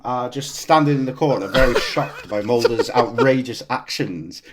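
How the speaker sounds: ordinary talk, nothing marked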